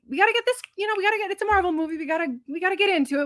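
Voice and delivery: high, hurried self-persuasion; coaxing oneself along. Retold or imitated. Viewer urging the Marvel movie to get going, strained patience. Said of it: "I'm like".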